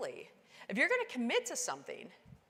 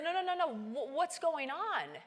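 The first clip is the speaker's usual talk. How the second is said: higher, rising alarm; panicked; 7 semitones higher than usual. Retold or imitated. Climber realizing the storm, panicking to her team. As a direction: stammer in disbelief.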